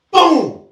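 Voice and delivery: loud high shouted boom; punch sound effect. Retold or imitated. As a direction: blurt the impact like a hit landing. Acting out sudden punch.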